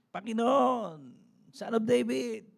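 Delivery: high pleading cry to the Lord. Retold or imitated. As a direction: call out high and loud for mercy. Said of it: "Sumisigaw siya".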